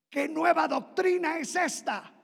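amazed exclaim. Retold or imitated.

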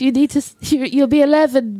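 ordinary talk, nothing marked

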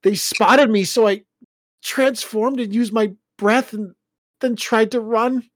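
high, loud, anxious recounting; distressed telling. Acted. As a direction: retell the attack, shaken and urgent. Son recounting attack distressed.

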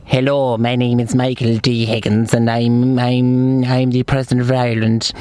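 low, put-on old man's voice; Michael D Higgins. Shown as a demonstration. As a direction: drop low and intone it like an old statesman. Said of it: "Here's my impressions".

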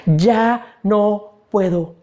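very slow, spent plea; exhausted tearful prayer, acted. Preacher voicing a worn-out believer praying, slow and spent. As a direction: break it word by word, fading away. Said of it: "estoy orando por esto".

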